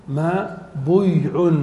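"ما بيع" slowly as maa-buy-un, damma on the ba; heavy, laboured form. Shown as a demonstration. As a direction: drag out each syllable of "ما بيع", with buy for bi. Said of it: "في ثقل".

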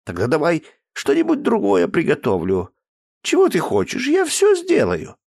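high pleading woman's voice; coaxing cook. Acted. Cook pleading through a locked door. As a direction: coax the girl to eat.

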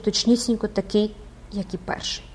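usual speaking voice

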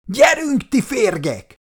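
high, loud battle roar. A narrator's reading. Commander charging enemies, roaring high and loud. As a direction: bellow it at the enemy. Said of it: "ordította".

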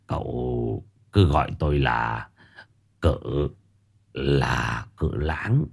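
trầm và nhỏ, giọng ông già chậm rãi; hạ giọng xuống như cụ già điềm đạm; giọng ông lão. Acted. Ông cụ già tự giới thiệu. (cụ già giới thiệu tên với Dần, giọng trầm chậm)

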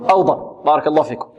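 usual speaking voice

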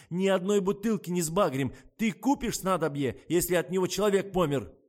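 high, loud, agitated harangue; irate rant. A narrator's reading. Kostya rants at Kira about unsellable syrup, irate. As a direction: shout the point home indignantly.

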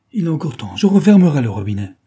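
acted last-chance appeal; desperate plea. A narrator's reading. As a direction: plead urgently, building to a cry.

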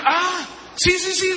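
high, loud eager yes yes; sudden realization. Retold or imitated. Pastor suddenly remembers the bill, high and loud. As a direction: jump up in pitch as it clicks.

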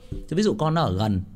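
usual speaking voice

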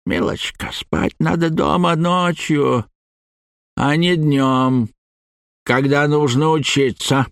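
put-on slow, mumbling chomping old lecturer; squelching flesh creature. A narrator's reading. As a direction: chew the words out slowly. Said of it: "прочавкал Хрюн". (чавкающий голос)

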